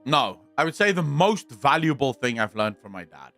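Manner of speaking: ordinary delivery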